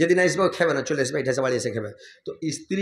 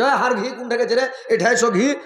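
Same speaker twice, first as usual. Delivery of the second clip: higher, louder calling across the house; 7 semitones higher, 4 dB louder than usual. Retold or imitated. Husband calling wife by pet name, loud call. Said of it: "আমি কইছি".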